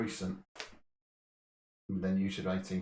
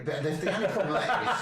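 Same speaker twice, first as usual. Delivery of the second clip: laughing; 10 semitones higher, 5 dB louder than usual. Real, not acted. Laughing during a quiz debate.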